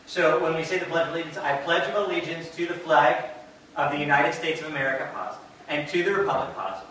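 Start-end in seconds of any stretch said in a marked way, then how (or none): 1.4-5.1 rote recitation. Shown as a demonstration. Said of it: "we emphasize certain words by rote". 5.7-6.5 rote recitation. Shown as a demonstration. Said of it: "you can hear the same rhythm".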